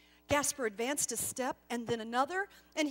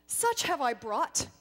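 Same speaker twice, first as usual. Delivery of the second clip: louder, self-assured boast; confident; 3 semitones higher, 8 dB louder than usual. Acted. Gasper boasting to the angel, confident. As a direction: say it sure of your own worth. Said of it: "Gasper spoke confidently".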